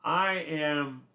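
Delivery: ordinary talk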